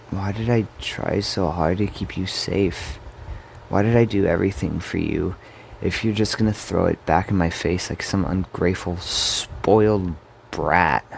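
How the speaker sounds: angry reproach building to heavy emphasis; bitter outburst. Acted. Brother berating the sibling he protected, bitter anger.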